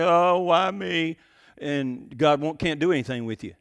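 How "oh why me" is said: slow, drawn-out mock whine of complaint; griping complaint. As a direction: drag it out like a self-pitying grumbler. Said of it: "gripe and complain and say".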